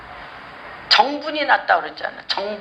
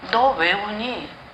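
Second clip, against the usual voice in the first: gentle voice of God; 4 semitones lower, 4 dB quieter, much slower than usual. Acted. God speaking to Hannah.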